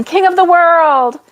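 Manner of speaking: high, exultant film line; triumphant high call; movie-quote holler. Acted. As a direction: cry it out like the actor, last word stretched. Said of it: "why I have to say it like that".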